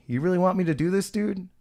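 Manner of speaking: high, quick retold question; incredulous appeal. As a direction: imitate asking him if he's serious. Retold pleading with defiant man.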